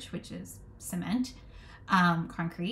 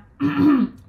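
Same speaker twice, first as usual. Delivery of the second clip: loud rough throat clear; 7 semitones higher, 14 dB louder than usual. Real, not acted. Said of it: "Losing my voice".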